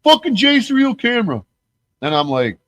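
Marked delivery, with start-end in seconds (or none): 0.0-1.4 very high, louder griping voice; high mock rant; mocking Flax. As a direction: whine it like a sore friend. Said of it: "he's like".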